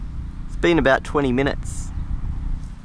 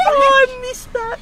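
high-pitched laughing, slow. Real, not acted. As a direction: squeal with laughter while talking. Laughing after missing the joke.

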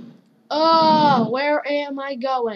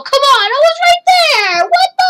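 frustrated yell; 13 semitones higher, 7 dB louder, faster than usual. Real, not acted.

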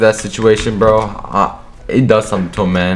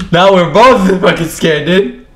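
nervous laugh; 10 semitones higher, 6 dB louder than usual. Real, not acted. Laughing nervously during standoff.

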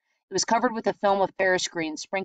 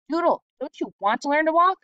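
frustrated urging; 9 semitones higher, 4 dB louder than usual. A narrator's reading. Brother discouraged pushing.

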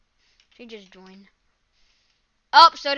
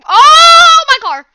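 shocked shout; 21 dB louder, much slower than usual. Real, not acted. Car flying in air.

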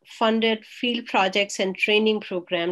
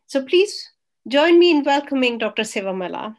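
louder, eager call to welcome the guest; excited welcome; 4 semitones higher, 7 dB louder than usual. Real, not acted. Moderator welcoming the guest speaker to the screen, louder and excited. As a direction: lift the room into applause.

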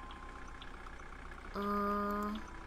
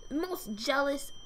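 normal talking voice

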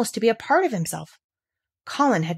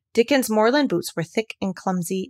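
ordinary delivery

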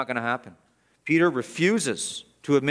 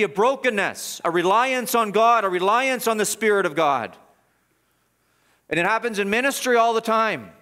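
higher, louder pulpit preaching; fervent preaching; 6 semitones higher, 4 dB louder than usual. Acted. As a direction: press the point home from the pulpit. Preacher raising intensity.

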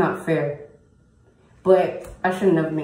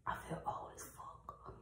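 very soft, voiceless whisper; whispering to self; 22 dB quieter than usual. Real, not acted. Vlogger to herself about her missing tooth, whispering. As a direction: whisper it privately, barely audible.

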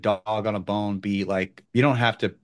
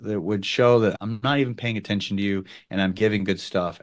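normal talking voice

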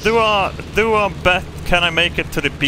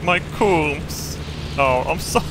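ordinary talk, nothing marked

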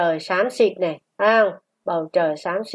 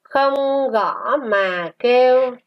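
slow, sustained syllables; model reading; 3 semitones higher, slower than usual. Shown as a demonstration. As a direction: read it slowly for a class to repeat. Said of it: "Chúng ta đọc lại". (đọc mẫu chậm, ngân dài)

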